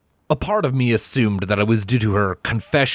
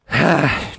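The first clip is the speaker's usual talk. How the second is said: sigh; 6 semitones higher than usual. A narrator's reading. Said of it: "only to sigh it out".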